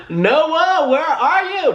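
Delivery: high, loud, hammed-up holler; joking call-out. Joking mock call to Noah.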